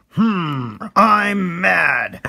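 high, loud, drawn-out cartoon huff; hammed-up mad little character; angry stick man. Cartoonist voicing the very angry stick man, hammed-up anger. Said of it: "he's really angry. He's like".

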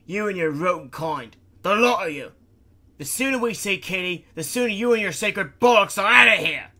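furious shout. Acted. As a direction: let the contempt explode. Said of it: "the engineer snapped".

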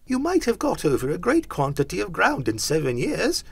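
high-pitched character voice; querulous Scrooge. A narrator's reading. Scrooge remarking on Jacob's travels, querulous. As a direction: reason with the ghost, pitched high.

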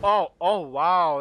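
loud, startled outburst; sudden loud disbelief; startled dismay. Real, not acted. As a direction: blurt it out as the jump fails. Sudden failed jump in game.

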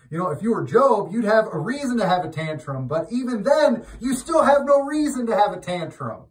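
loud, high, fired-up sermon voice; preaching at full force. Acted. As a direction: build it up and hammer it home to the flock. Preaching peak continues.

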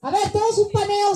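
ordinary talk, nothing marked

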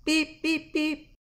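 high, loud machine beeps; three even electronic-style beeps; imitated beeping. Shown as a demonstration. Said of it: "Machine noises".

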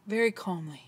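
low, hushed aside; very calm. Shown as a demonstration. Preacher describing Jesus's last words, very calmly. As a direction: drop to a soft, low murmur.

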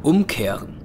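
ordinary talk, nothing marked

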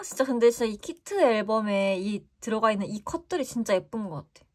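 ordinary delivery

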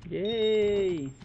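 high, loud little yay; excited cheer. Real, not acted. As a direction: cheer a small win. Small win cheer.

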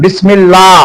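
"বিসমিল্লাহ" with a fine, light "লা"; thin lam. Shown as a demonstration. Teacher demonstrates the rule after zer, "বিসমিল্লাহ" with thin lam. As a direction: read "বিসমিল্লাহ" with the l kept thin. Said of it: "পাতলা বা বারিক করে পড়তে হবে".

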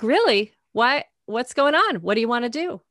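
replaying her own eager pep talk to a friend; eager excited reply. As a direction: jump in like a friend who can't wait to book the trip. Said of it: "I'm like".

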